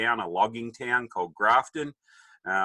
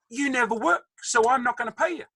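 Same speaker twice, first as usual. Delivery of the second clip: high-pitched mock retort; exasperated dad. Dad refusing to pay his son, mock exasperation. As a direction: fire back as a fed-up father, pitched up.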